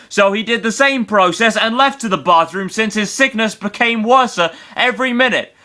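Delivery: reading pitched high and worked up; wild unhinged ranting. Acted. Reading builds higher and higher.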